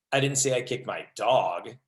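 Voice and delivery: final word "dog" drawn out and punched; stress on DOG. Shown as a demonstration. Trainer showing tone changes meaning, stress on dog. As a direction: save the emphasis for "dog" at the end. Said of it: "same sentence with seven different meanings".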